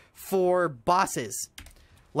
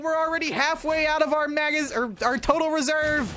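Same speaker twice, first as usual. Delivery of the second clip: gung-ho soldier; 9 semitones higher than usual. Retold or imitated. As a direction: cheer like a brainless trigger-happy trooper. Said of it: "where it's like".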